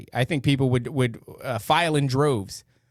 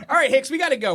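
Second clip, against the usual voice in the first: laughing while talking; words breaking into laughter; 13 semitones higher, faster than usual. Real, not acted. Laughing through the call.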